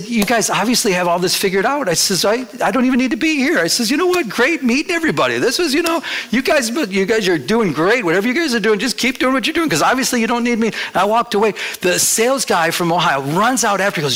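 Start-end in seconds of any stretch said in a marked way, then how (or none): 0.0-10.7 high-pitched, over-polite sign-off; acted upbeat farewell, pitched well up; retold cheerful brush-off. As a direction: lift the voice high, excusing yourself breezily. Retells excusing himself from hostile meeting.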